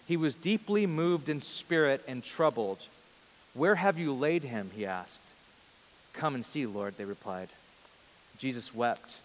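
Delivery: measured, solemn Bible reading voice; scripture reading. Acted. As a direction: pastor reading the Gospel to the church, solemn and measured.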